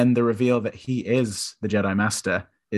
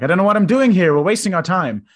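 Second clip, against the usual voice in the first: loud, high frustrated outburst; frustrated Luke; 8 semitones higher, 7 dB louder, faster than usual. Retold or imitated. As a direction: blurt it out, fed up and exasperated. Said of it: "Luke gets really frustrated and he's like".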